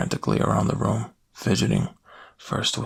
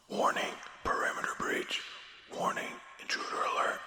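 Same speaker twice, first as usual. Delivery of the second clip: quiet automated warning voice; machine alarm; 11 dB quieter than usual. Acted. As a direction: sound a hushed intruder alert like a machine.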